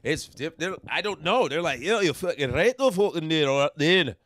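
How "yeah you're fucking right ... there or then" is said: louder, garbled Scottish burr; Scottish accent. Acted. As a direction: mangle it in incomprehensible Scottish. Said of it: "the worst is the Scottish".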